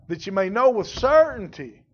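KNOW and CERTAINTY punched loud and high; emphatic preacher's KNOW with CERTAINTY; stress on KNOW and CERTAINTY. Shown as a demonstration. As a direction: drive home KNOW, then CERTAINTY. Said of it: "may is the wrong word to emphasize".

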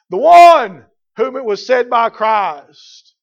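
loud, mounting pulpit list; full-force preaching. Acted. Preacher listing who John the forerunner was, full-force preaching.

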